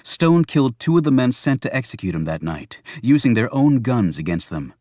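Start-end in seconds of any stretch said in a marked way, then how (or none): none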